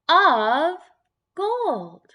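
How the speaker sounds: loud, high of gold; triumphant. Acted. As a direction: proudly land the answer. Triumphant reading of title.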